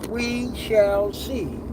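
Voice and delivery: very slow, measured words; drawn-out musing. Real, not acted. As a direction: stretch each word out, musingly. Rephrases it with drawn-out formality.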